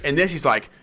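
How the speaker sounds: usual speaking voice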